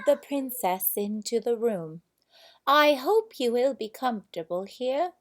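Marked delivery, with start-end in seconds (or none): 2.7-5.1 queen's voice. A narrator's reading. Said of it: "she said".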